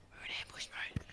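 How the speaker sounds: hushed stealth whisper; voiceless, secretive announcement. Pretend. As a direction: whisper it as if lying in ambush. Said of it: "You have to whisper".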